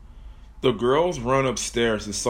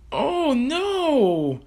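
scream. Acted. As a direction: shriek in terror. Kelsey about to die.

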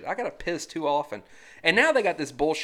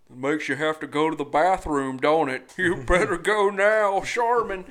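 sly, louder ad-bear pitch; hammed-up toilet paper bear, louder; cartoon bear ad voice; 6 dB louder than usual. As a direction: tease the viewer like a smug mascot. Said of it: "And he's like".

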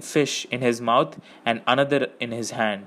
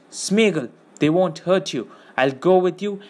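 higher, earnest promise; urgent reassurance; 6 semitones higher than usual. A narrator's reading. Frodo to captured Smeagol, urgently reassuring. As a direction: reassure him pressingly.

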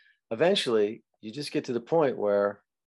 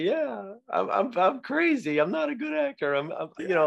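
chuckling at yourself; 9 semitones higher than usual. Retold or imitated. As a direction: laugh off your own mistakes. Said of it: "chuckle about that".

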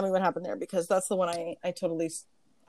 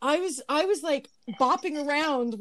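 excited gushing; 6 semitones higher, slower than usual. Real, not acted. Gushing about the concert.

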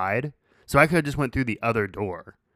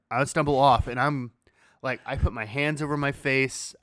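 ordinary talk

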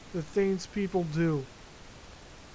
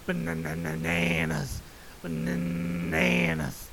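silly episode title voice; 12 semitones lower than usual. Pretend. Host announcing the episode title in a goofy deep drawn-out voice. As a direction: drop way down low, then stretch it out slow. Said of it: "just call this episode Bananas".

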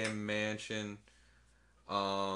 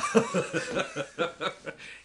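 breathy laugh; 6 semitones higher than usual. Real, not acted. Laughing at the impression.

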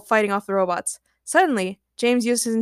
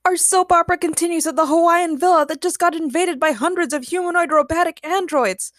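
high, loud soap opera announcer; mock announcer; 8 semitones higher, 5 dB louder than usual. A narrator's reading. Ray jokes about the robot invasion, mock announcing. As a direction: announce it with hammed-up drama. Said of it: "Ray laughed".

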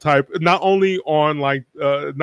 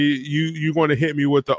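ordinary talk

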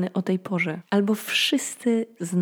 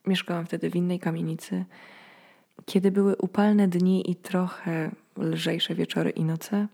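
usual speaking voice

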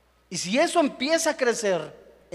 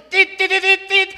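much higher, louder tit-tit horn honks; car horn beeps; 11 semitones higher, 7 dB louder than usual. Retold or imitated. Preacher imitating a car horn in traffic, high and loud. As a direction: honk like an angry driver.

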